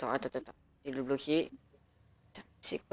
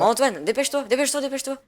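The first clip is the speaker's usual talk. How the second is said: panicked urgent call; urgent and rushed; 7 semitones higher, 5 dB louder than usual. Acted. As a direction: hurry someone, higher and louder.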